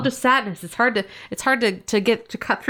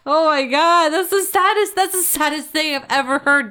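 mock heartbroken; 8 semitones higher, 3 dB louder than usual. Reacting to sad hot dog.

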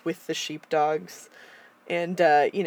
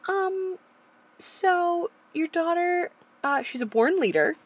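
tactful teacher; 10 semitones higher than usual. Retold or imitated. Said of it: "the teacher was like".

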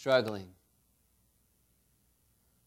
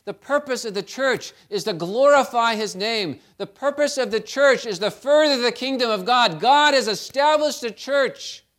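louder and higher with each line; building sermon climax; 4 semitones higher, 6 dB louder than usual. Acted. Preacher driving home the church's purpose, loud. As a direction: preach it with rising force.

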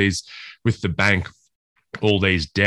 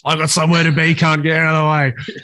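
mock impatient driver; 7 semitones higher than usual. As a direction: play the grumpy driver who wants past, half in jest. Mocking the honking driver.

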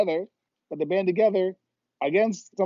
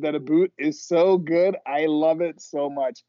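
usual speaking voice

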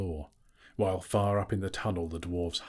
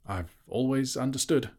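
high, timid hobbit voice; frightened squeak; 6 semitones higher than usual. A narrator's reading. Bilbo answers the boasting dragon in a frightened squeak. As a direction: squeak it nervously. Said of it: "in a frightened squeak".

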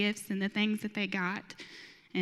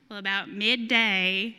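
ordinary delivery

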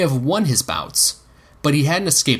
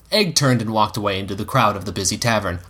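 ordinary delivery, nothing marked

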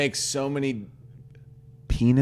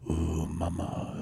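sultry whisper; 7 semitones lower, 8 dB quieter, slower than usual. Pretend. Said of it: "get all up in the ear and go".

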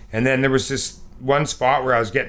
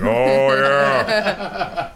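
laughing mock. Mock code talk, laughing.

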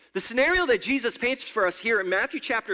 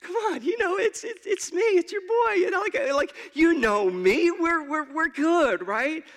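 much higher, shaky nervous pleading; quivering plea; 8 semitones higher than usual. Retold or imitated. Pleading with Jesus at the gate, quivering higher voice. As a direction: beg your old friend not to forget you. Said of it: "with a little quiver in your voice".

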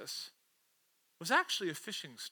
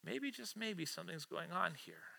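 soft, musing thought aloud; wondering to himself; 3 semitones lower, 13 dB quieter than usual. Acted. Peter on the boat starting to suspect, wondering softly. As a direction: let a suspicion dawn, well under full voice. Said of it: "he starts wondering to himself".

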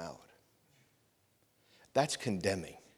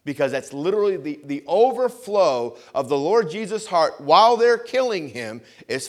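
usual speaking voice

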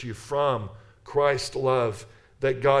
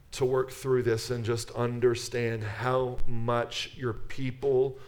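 prayer. Acted. Leads closing prayer.

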